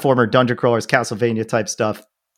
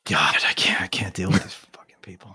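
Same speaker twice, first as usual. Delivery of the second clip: whispered, fed-up grumble under the breath; exasperated whisper; 6 semitones lower, 8 dB quieter than usual. Real, not acted. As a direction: let the frustration slip out in a whisper. Exasperated aside about teammates.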